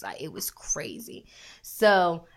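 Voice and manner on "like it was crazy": soft unvoiced whisper. Real, not acted. Telling her co-host about getting lost, whispering. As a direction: drop to a whisper.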